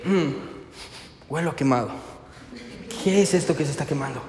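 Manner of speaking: low, soft thinking aloud; mock puzzled musing. Preacher imagines James with his hand on the grill, mock pondering. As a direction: play slowly dawning puzzlement, low and quiet.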